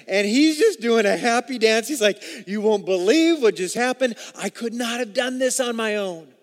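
loud, joyful preaching voice; exuberant preaching. Acted. Pastor acting out Moses' joy for the church, loud and exuberant. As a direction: ride the energy of Moses' happy dance.

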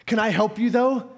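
ordinary talk

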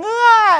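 high, loud open-mouthed wail; mock infant "waah"; imitated baby cry. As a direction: mimic a bawling baby, briefly. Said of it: "because he's crying".

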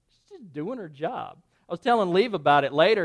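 ordinary talk

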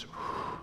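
breathy "whew" with no voice; imitated relieved exhale. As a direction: let out a long quiet breath of release. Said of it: "And she just goes".

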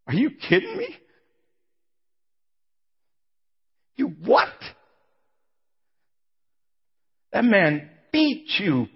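incredulous outburst. Retold or imitated. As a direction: cry out in stunned disbelief.

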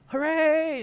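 high loud hooray; mock crowd cheer. Pastor voicing the crowd cheering the healing, excited.

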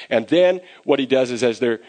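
ordinary delivery

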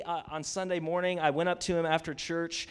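ordinary delivery

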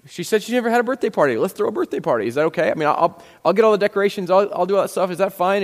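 eager pitch. Retold or imitated. Tony pitches the party to the diner owner, eager. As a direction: act out someone keenly pitching a plan.

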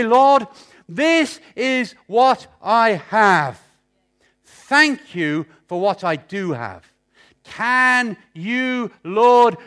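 slow, earnest prayer to the Lord. Acted. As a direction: plead slowly with God. Praying aloud to the Lord.

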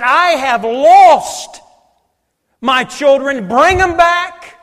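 slow, drawn-out plea, rising loud on bring 'em back; pleading voice of God. Acted. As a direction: stretch each word like a father calling his lost children home. God pleading in quoted prayer.

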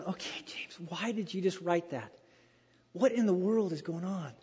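puzzled inner voice. Retold or imitated. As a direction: muse to yourself, baffled. Preacher's puzzled inner thoughts.

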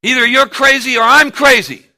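high, loud angry retelling; furious father yelling at Matt; angry outburst. Retold or imitated. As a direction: shout it at your son again. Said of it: "I was so angry".

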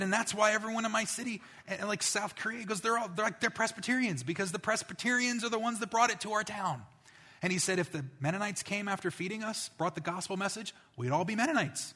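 high and eager throughout; excited. Retold or imitated. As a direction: pastor telling it with animation.

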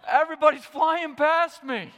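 new driver's hammed-up freak-out; panicked. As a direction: yelp it as cars whiz past you. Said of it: "that other split second where you're like".

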